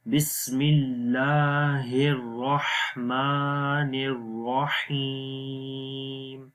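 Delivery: slow melodic tajweed chant, long vowels; slow recitation. Shown as a demonstration. Teacher recites the verse again for the students. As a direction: recite it slowly and evenly. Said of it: "اب اس کو دوبارہ پڑھتے ہیں".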